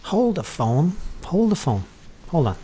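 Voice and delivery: sudden high "wait a second"; surprised. Real, not acted. Tutor spots a mistake.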